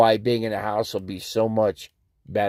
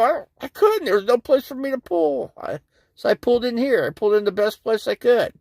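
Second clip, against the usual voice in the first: high-pitched defensive excuse; retold defensive protest; 11 semitones higher than usual. Driver explaining to officer where he pulled in, defensive. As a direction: plead your case, voice pitched well up.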